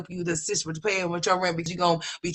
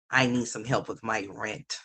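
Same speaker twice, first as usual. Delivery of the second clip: lower, mocking voice of the tenant asking for rent; mocking imitation; 12 semitones lower, 5 dB quieter than usual. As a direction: mimic her request low and dragging.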